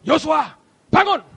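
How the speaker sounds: God orders Joshua to get up, loud and stern; commanding God. Acted. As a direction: rouse him sharply. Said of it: "Dia berkata".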